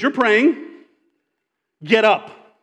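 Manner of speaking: stern command. Retold or imitated. God rebukes Joshua.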